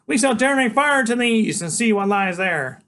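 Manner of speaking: put-on small boy waking his big friend for a quest; eager boy genius. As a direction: rouse him like a tiny herald. Freak voiced.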